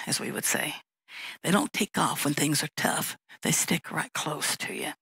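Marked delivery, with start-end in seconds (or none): 0.1-0.8 whispered aside. Real, not acted. Aside dropping to a hush.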